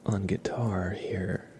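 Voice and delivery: soft unvoiced whisper, slow; hushed slow whisper. Real, not acted. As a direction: whisper it gently to the stream. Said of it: "my soft speaking and whispering".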